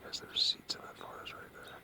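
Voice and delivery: hushed unvoiced whisper so nobody hears; quiet hiker's whisper. Real, not acted. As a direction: whisper it close to the mic. Hushed near other hikers.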